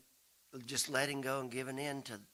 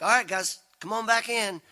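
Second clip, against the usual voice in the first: loud, high call across the yard; 7 semitones higher, 9 dB louder than usual. Retold or imitated. Dad hollering from the porch for the kids to come back in, loud. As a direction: holler them back inside.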